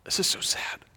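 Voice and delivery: whispered, as a private thought; hushed aside. Retold or imitated. Chaplain thinking to himself during the ritual, whispered dismay. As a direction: murmur it under the breath, voiceless. Retold private reaction to the chanting.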